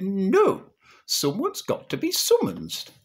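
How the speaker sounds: high, insistent Northern mother; comic high-pitched objection; awkward Mother. Acted. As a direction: refuse loudly and demand action. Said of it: "turned a bit awkward".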